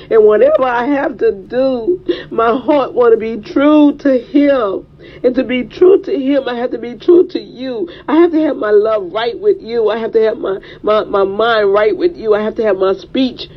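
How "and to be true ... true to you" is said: normal talking voice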